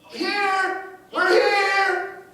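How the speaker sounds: high, loud shout. Retold or imitated. Greeter running downstairs to meet them, loud shout. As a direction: shout to be seen.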